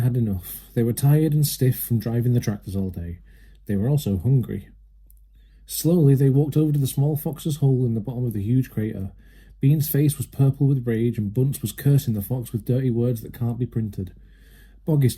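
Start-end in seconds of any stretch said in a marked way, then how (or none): none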